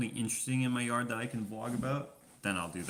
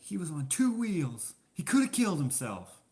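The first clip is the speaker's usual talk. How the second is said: mock outraged lady; 8 semitones higher, 5 dB louder than usual. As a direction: echo her outrage in a high voice. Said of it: "It's like".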